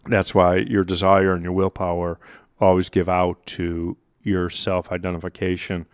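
ordinary delivery

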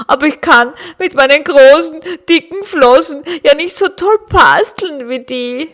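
gloomy, tearful walrus; a clumsy walrus in despair; sad walrus voice. Acted. Walrus pours out woes. (verzweifeltes Walross)

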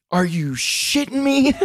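put-on high, slow, drawn-out disbelieving girl's voice; daughter swearing. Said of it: "she's like".

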